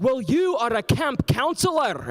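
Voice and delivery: high, slow, put-on reprimand; scolding camp director. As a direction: lecture pompously, stretching each word. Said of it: "And he was like".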